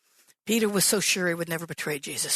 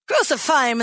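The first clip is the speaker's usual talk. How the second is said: higher, louder crowd yell; crowd screaming; 6 semitones higher, 7 dB louder than usual. Retold or imitated. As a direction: shout it like an angry mob. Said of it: "they screamed it louder".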